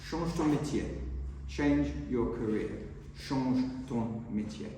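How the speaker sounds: put-on lightly French-accented advice; French phrase. Head waiter tells the young waiter to change career, in French. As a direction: say the French words softly, slowing at the end. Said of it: "He said".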